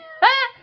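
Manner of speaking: loud, high burst of laughter. Retold or imitated. Acting out his laugh in the dream, high and loud. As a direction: let out a shrieking laugh. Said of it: "He just starts laughing".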